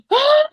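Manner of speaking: sharp, very high, loud frustrated "Ah!"; angry yell. Retold or imitated. Finding her bulbs eaten, a retold furious yell. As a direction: shriek at the ruined garden. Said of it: "I was so mad".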